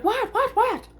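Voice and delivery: high, startled repeated what; quick high panicked questions; alarmed mom. Retold or imitated. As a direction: mom jolted awake and alarmed. Mom woken by screaming.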